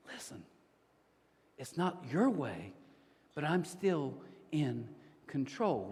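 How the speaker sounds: slow, hushed voice of God; gentle, measured reassurance; calm, assured. Acted. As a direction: speak softly and slowly, with quiet authority. Voicing God's calm reply.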